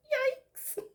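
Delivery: high, loud squealing yikes. Acted. Narrator reacting to the racket, squealing. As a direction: squeal it with a laugh.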